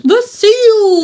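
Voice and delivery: high, loud mock game-villain cry; Voldemort. Mocking a game's bad voice acting, hammy villain cry. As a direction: imitate overblown fantasy voice acting. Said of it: "Voldemort, whatever his damn name is".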